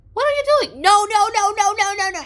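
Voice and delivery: high, loud repeated no; loud refusal. Retold or imitated. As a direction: refuse in alarm. Sherman refusing loudly.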